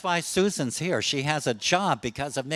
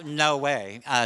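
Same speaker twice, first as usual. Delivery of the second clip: normal talking voice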